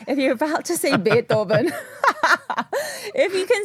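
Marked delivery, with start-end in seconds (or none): 0.1-1.7 louder Hindi-accented "Beethoven"; "Beethoven" in an Indian accent. Shown as a demonstration. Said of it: "in a Hindi accent". 1.7-3.1 high burst of laughter; sudden high-pitched giggle; laughing. Real, not acted. Cracks up at own accent slip.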